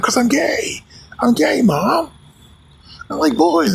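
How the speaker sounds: high, mock-dramatic confession; hammed-up coming-out; son confessing.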